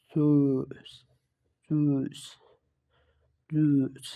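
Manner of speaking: "জুস" with rounded lips while trying to say "i"; rounded U-umlaut. Shown as a demonstration. Teacher models U-umlaut for learners, long rounded "জুস". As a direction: hold the ü in "জুস", each repeat separate. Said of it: "ঠোঁট দুটো গোল রেখে".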